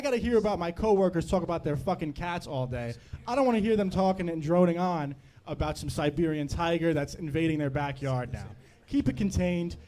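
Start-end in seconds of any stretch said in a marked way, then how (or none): none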